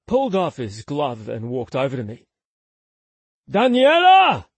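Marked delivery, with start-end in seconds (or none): 3.5-4.5 loud call to the back room. Acted. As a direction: shout her name across the shop. Emilio calls to the back.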